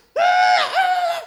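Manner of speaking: put-on high shouted child "ah"; tantrum cry. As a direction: yell like a sulking kid.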